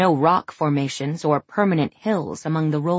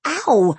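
sharp yelp of pain, louder; 6 semitones higher, 5 dB louder than usual. Acted. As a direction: yelp as if punched. Punched hard on the shoulder.

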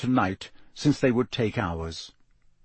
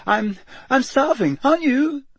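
much higher, forced chatter; unnaturally high; 12 semitones higher than usual. Acted. Shaken Neville babbles to friends, much higher. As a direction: babble to hide the shock. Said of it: "in the same unnaturally high voice".

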